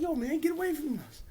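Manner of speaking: high-pitched hammed-up plea to the zombies; mock fright. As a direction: squeal it like a scared commentator. [name] fleeing zombies.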